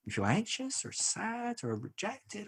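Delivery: high, soft, slow gentle question; tender, careful questioning; imitating caring wife. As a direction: softly probe as a concerned partner. Wife gently probing, retold.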